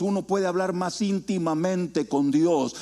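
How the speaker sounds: normal talking voice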